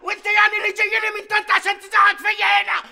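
high, loud yelling retort; angry shout back. Acted. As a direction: yell right back at him. Other merchant shouts back.

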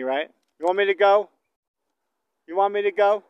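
loud, high calls across a field; calling out to cattle. Real, not acted. Rancher calling out to the herd, loud and high. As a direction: holler to get the cows moving.

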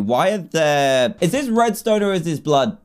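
normal talking voice

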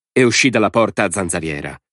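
ordinary delivery, nothing marked

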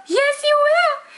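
put-on very high, slow cooing baby talk. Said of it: "No, you're not a baby".

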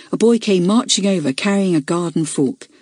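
ordinary delivery

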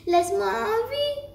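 high, pleading cry. A narrator's reading. Grandmother begging the wolf for her life, high plea. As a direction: beg with the pitch climbing high. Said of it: "supplia-t-elle".

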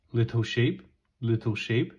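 usual speaking voice

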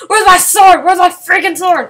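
high, loud desperate shouting; frantic yell. Real, not acted. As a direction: scream for the missing weapon. Frantic search mid fight.

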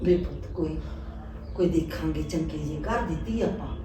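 quiet, low, coaxing words to her son; soft consoling. Acted. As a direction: comfort him gently, hushed. Mother softly consoles son. (ਹੌਲੀ ਪਿਆਰ ਭਰੀ ਤਸੱਲੀ)

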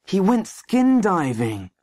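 ordinary delivery, nothing marked

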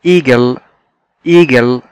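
إيجل said clearly for repetition; إيجل pronounced carefully; model pronunciation. Shown as a demonstration. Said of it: "مرة كمان".

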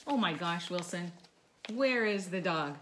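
usual speaking voice

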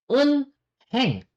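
"unheng" high, in two parts, un, then heng; spelling pronunciation. Shown as a demonstration. Korean teacher reading the word for bank, spelling pronunciation. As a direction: sound out the h in "unheng". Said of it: "But that is pronounced as uneng".

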